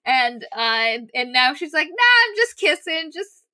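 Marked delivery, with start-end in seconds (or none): 1.9-3.4 a high, offhand shrug of a line; mock nonchalance. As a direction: lightly play it casual and cheeky. Kissen shrugs off the question.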